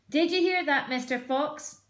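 high, loud jeering yell. Acted. Bean taunting the fox in the hole, loud yell. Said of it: "yelled Bean".

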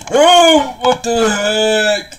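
high, loud startled cry, slowing down on the words; high shocked yelp that drags out. Acted. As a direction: jump at the scare and shriek. Startled by a jump scare.

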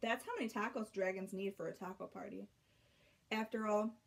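usual speaking voice

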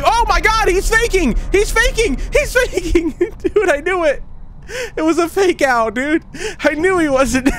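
very high, laughing disbelief as the chef chases; shrieking in shock and laughing; startled shriek. Real, not acted. Player caught by the fake-out chef, shrieking and laughing.

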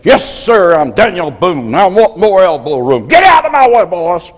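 a bold schoolboy comeback; defiant boast. Retold or imitated. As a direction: stand tall and announce yourself. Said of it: "go like this, say".

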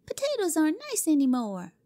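put-on high, bored child voice dismissing potatoes; a spoiled girl waving the idea away; scornful girl. Cora rejecting Jimmy's potatoes: scornful high girl voice. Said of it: "made a scornful gesture".

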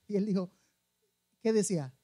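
usual speaking voice

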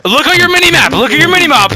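high, loud exasperated yelling; furious yelling. Real, not acted. Gamer yelling at teammate Walter, high furious shouting. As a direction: scream it at the useless teammate.

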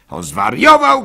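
high, loud outcry; shouting wife. Acted. Wife shouting to the rabbi, loud protest. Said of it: "krzyczała".

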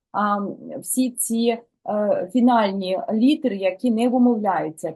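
usual speaking voice

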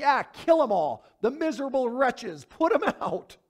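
high, loud gleeful "kill 'em all"; bloodthirsty crowd. Retold or imitated. Preacher imitating the crowd calling for punishment, high and loud. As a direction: ham up the crowd's eager verdict. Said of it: "they were like".

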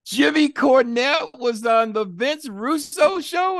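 very high, slow, drawn-out mimicked voice of the host; Vince Russo impression. Host asking on his show, in a high slow mock voice. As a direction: stretch each word up high like a startled host. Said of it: "if you know the voice".

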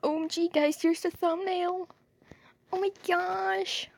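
high, hyped vlogger shout-out; excited to viewers. Real, not acted. Showing viewers the thumbnail, high hyped voice. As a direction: hype up the audience.

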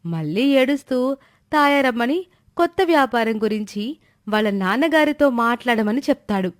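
plain reading voice

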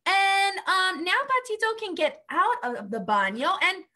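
usual speaking voice

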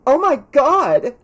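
high, loud, drawn-out exclamation; slow, high gasp of disbelief; shocked. A narrator's reading. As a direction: cry out in horror at the news. Pam shocked at news of death.

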